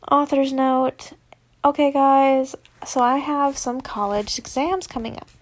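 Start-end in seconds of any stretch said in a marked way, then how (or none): none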